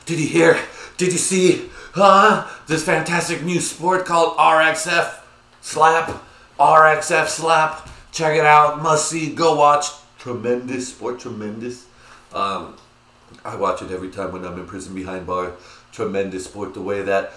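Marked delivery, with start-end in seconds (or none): 0.1-11.9 high, loud excited pitch to coworkers; excited like a child. Retold or imitated. Telling the warehouse guys about the sport, childlike excitement. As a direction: gush like a kid running up with news. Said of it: "I was like a fucking child".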